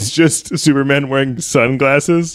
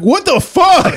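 shocked outburst; slower than usual. Retold or imitated. Joaquin Phoenix hearing the AI voice turn male, high shocked cry. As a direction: blurt it out in horror. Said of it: "Joaquin Phoenix is like".

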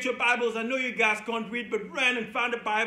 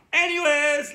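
loud reset; 4 semitones higher, 9 dB louder than usual. Real, not acted. Teacher dropping the grandpa act for the kids, loud and brisk. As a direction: burst back into full energy.

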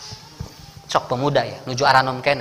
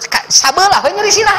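high, loud mock whine; complaining wife; 12 semitones higher, 5 dB louder than usual. Wife telling the ustaz her pain, mock whine. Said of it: "abdi mah cenah jo".

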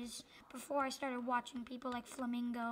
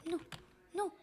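ordinary delivery

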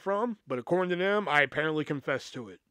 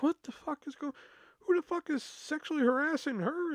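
high-pitched, softer disbelief; bewildered; 11 semitones higher, 4 dB quieter than usual. Retold or imitated. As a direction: retell being baffled, voice pitched way up. Bewildered reaction to the accusation.

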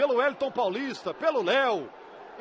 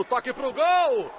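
long stretched goal scream, higher; goal shout; 5 semitones higher, slower than usual. Real, not acted. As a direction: belt out the goal call. São Paulo goal being called.

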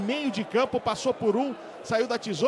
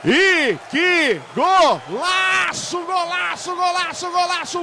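long drawn-out goal scream; explosive goal commentary; 4 semitones higher, 6 dB louder, much slower than usual. Real, not acted. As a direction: stretch out the goal call, yelling. Goal scored, commentator erupts.

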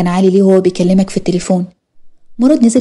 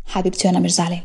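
soft reassurance; 7 dB quieter than usual. Acted. Husband reassures wife he's not upset.

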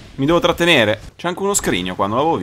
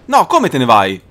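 ordinary talk

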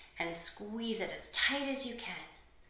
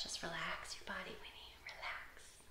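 very soft whispered aside; slowing, hushed reassurance; soothing whisper; 12 dB quieter than usual. Real, not acted. Soothing aside to one child.

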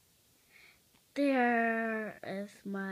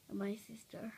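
whisper; 4 semitones lower, 12 dB quieter than usual. Real, not acted. Child whispers tour.

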